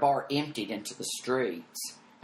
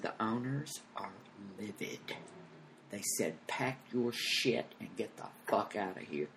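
very soft, deliberate bad news; grave hushed report; 3 semitones lower, 10 dB quieter than usual. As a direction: break the bad news quietly. Producer relays owners' anger discreetly.

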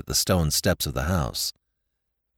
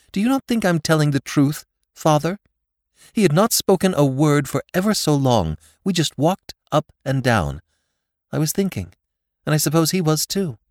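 higher and louder, near tears; a daughter on the verge of tears pleads with her father, higher; pleading daughter; 8 semitones higher, 4 dB louder than usual. A narrator's reading. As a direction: beg him to believe her.

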